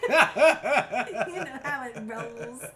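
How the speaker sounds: catchphrase broken by laughter; laughing through it. Real, not acted. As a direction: try to say it, but crack up. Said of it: "I swear I can't".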